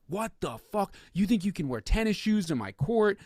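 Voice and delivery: hammed-up furious judge scolding; angry judge. Judge berating mom in court, imitated angry rant. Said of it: "He's like".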